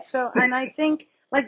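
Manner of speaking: normal talking voice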